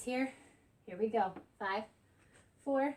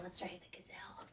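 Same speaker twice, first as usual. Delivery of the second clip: whispered aside, much softer; 5 semitones lower, 16 dB quieter, faster than usual. Retold or imitated. Trainer confiding a joke to viewers in a whisper. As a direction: breathe it like a secret joke.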